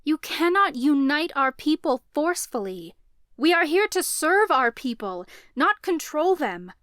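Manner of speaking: loud, high passionate protest; impassioned protest. A narrator's reading. Nadine protesting to the priestesses, loud and high. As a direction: plead the cause hard.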